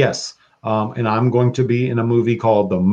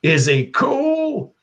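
very high, very slow Yondu question; much slower than usual. Retold or imitated. Yondu asking the crew about Mary Poppins, high slow voice. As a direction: drag the question out high and strange. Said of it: "And he was like".

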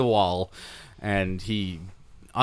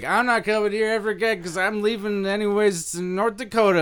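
high whiny imitation of a drunk; lightly voiced drunk, pitched up; mock drunk patron; 10 semitones higher than usual. Bartender mimicking the drunk customer, whiny and high. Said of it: "He's like".

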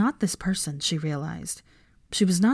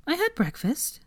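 defensive; 9 semitones higher, 3 dB quieter than usual. A narrator's reading. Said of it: "she said defensively".

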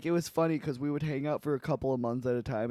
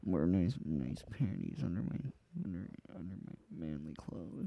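much lower and softer; hushed, low mock confession; low muttered aside; 7 semitones lower, 11 dB quieter than usual. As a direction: murmur it to yourself, trailing away. Muttered aside echoing his own line.

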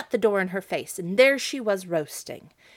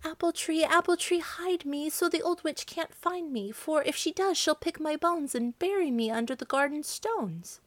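high, urgent plea; young girl crying out high and pleading; frightened girl pleading; 8 semitones higher than usual. Acted. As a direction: beg the tree to hide you before the witch comes. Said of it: "so she ran to it and cried".

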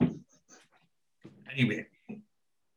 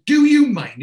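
high, loud outraged question; indignant shriek; 12 semitones higher, 9 dB louder than usual. Retold or imitated. Barry loudly objecting to Wayne's crude remark.

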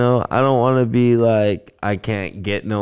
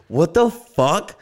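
high, slow, drawn-out disbelief; stunned disbelief; 8 semitones higher, much slower than usual. Retold or imitated. Recounting the cop's tattoo accusation, slow and high in disbelief. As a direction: let it stretch out, baffled. Retelling reaction to prison-tattoo claim.

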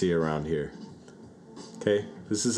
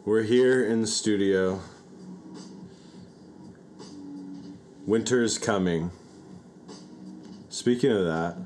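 forceful; 4 semitones higher than usual. Acted. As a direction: come in hot. Aggressive hosting.

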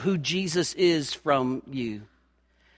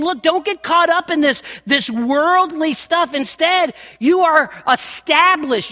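raised, fervent preaching voice; 8 semitones higher, 4 dB louder than usual. Acted. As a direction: preach it out with full conviction. Preaching at full force.

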